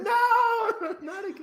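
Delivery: long, high mock wail; mock anguished cry. Joking about eight years later, a high mock wail of despair. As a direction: wail it high, breaking into laughter. Acted joke movie cry.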